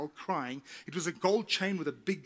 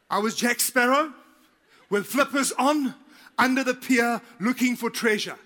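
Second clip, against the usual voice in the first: excited storytelling; 4 semitones higher, 6 dB louder than usual. Real, not acted. Comic pirate self-image.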